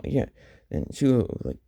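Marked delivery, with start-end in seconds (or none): none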